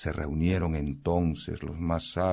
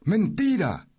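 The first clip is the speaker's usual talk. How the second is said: scornful denial; 14 semitones higher, 4 dB louder than usual. Acted. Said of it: "dijo la piedra".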